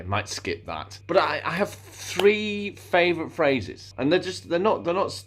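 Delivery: normal talking voice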